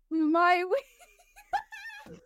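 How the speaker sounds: high, giggly attempt to speak; breaking into laughter. Real, not acted. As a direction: try to say it while cracking up. Breaks up laughing at own segment.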